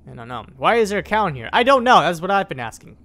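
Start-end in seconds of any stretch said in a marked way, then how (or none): 0.1-0.5 low, soft admission of not knowing; quiet musing. Real, not acted. Player puzzled by a cow in the cave, quiet musing. Muses to self before loud repeat. 1.5-2.0 loud, high burst of bafflement; exasperated. Real, not acted. Player answering own question about the cow, loud exasperation.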